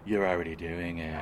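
1 mock guru voice. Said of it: "And then a voice said".

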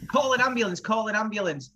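high-pitched urgent appeal, lightly acted; mock-panicked plea; mock alarm. Acting out someone finding bloodied child.

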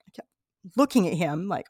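usual speaking voice

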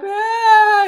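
a high, loud, crying "Ah"; imitated child wail. A parent imitating a crying child with a high, loud wail. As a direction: wail like a toddler who can't say why. Child cries without knowing why.

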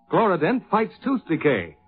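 ordinary talk

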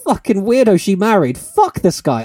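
hot-headed outburst, half joking; mock outrage. Real, not acted. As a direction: rant at the weirdo she married. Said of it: "Bit aggressive. Coming out hot".

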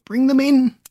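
high, cracking weak shout; voice breaking. Acted. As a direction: let the voice break with weakness. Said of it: "voice broke with weakness".

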